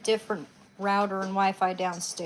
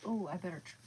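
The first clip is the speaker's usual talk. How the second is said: ordinary delivery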